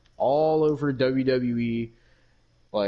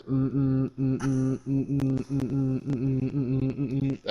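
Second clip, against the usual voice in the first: imitating muffled hums; 2 semitones higher than usual. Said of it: "all I heard was".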